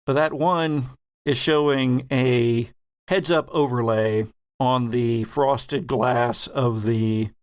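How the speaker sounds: normal talking voice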